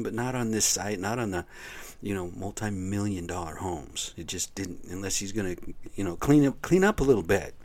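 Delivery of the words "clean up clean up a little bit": louder, higher mock command to clean up; mock order. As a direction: bark it like telling the guy off. Telling the guy to clean up.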